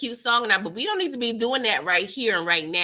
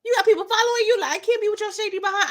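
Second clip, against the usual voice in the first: higher, louder, quicker imitation of Tate; mock Tate; 10 semitones higher, 3 dB louder, much faster than usual. Recapping Tate warning Tariq, hammed-up high quick voice. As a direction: rattle it off as a jumpy, suspicious Tate. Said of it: "He was like".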